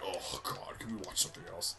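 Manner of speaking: quiet, half-whispered imitation of dad griping; mock weary mutter; retold grumble. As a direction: grumble it low under your breath, imitating him. Imitating dad's reluctant complaint.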